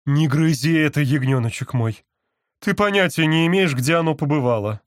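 scolding mother. A narrator's reading. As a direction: scold the toddler fondly. Barbara scolds toddler.

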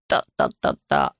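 low staccato rat-a-tat; imitated machine-gun fire. A narrator's reading. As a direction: mimic gun bursts, low and evenly spaced. Imitates gunfire while telling war story. (изображая пулемётную очередь)

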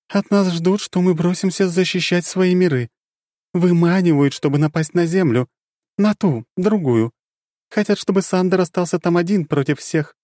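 high, grave warning; dark goddess warning of danger; serious goddess. Acted. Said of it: "стала очень серьёзной".

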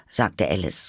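ordinary delivery

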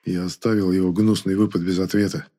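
plain reading voice

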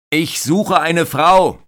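high, loud and slow shouted words. Acted. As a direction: yell each word slowly over the din. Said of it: "schreit Carsten Zara". (langsam und laut geschrien)

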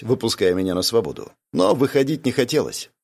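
ordinary delivery, nothing marked

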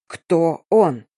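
each word hammered apart; a restrained growl, word by word; growled demand. A narrator's reading. Boss demands the lover's name, chiselling each word. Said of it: "прорычал хозяин".

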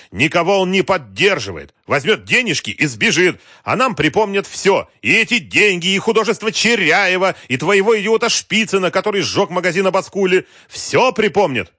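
loud, high-pitched enraged rant; furious yelling. A narrator's reading. Wolf berating Galkin over the money, yelling. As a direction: yell each grievance at the top of your voice. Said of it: "орал Вольф".

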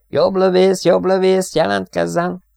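high, loud radio hail; intercom call. A narrator's reading. Pilot hails the gunner over the intercom, calling. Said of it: "Dennis hangja az egymás köztin".

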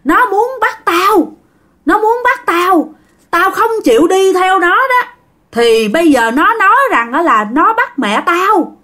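a frightened madman insisting someone wants to take him; crazed uncle crying out. Retold or imitated. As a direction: uncle cries out to the family in high panic. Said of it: "cậu Út mới la làng".

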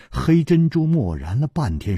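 ordinary delivery

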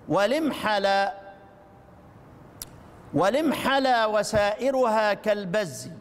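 slow measured verse recitation. Shown as a demonstration. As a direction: draw the verse out slowly. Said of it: "أقرأ لكم البيت". (تلاوة بطيئة للبيت)

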